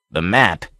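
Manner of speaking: usual speaking voice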